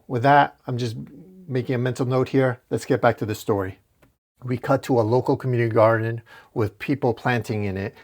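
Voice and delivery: ordinary talk, nothing marked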